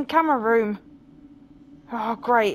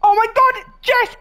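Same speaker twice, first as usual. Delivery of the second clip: raised frightened cry; scared; 8 semitones higher, 6 dB louder than usual. Real, not acted. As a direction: call out in fright. Ghost at the door.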